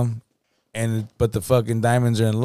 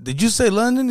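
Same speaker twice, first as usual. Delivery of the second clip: put-on old man voice; 10 semitones higher than usual. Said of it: "turns around and says".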